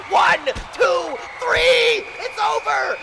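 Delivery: high, loud three count; shouted pin count. Acted. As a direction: scream the count to the crowd. Calling the pinfall count.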